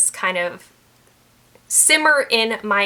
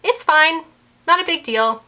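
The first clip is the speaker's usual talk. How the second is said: higher-pitched, breezy brush-off; easygoing no-big-deal reaction; laughing it off; 7 semitones higher, 4 dB louder than usual. Acted. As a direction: shrug it off lightly, pitched up. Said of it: "they'd probably just laugh it off".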